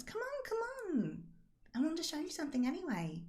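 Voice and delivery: high, soft coaxing game character; Rosalind, coaxing. Acted.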